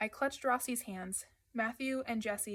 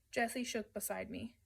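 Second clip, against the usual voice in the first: plain reading voice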